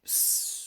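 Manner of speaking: faint unvoiced sleepy hiss; soft snake hiss.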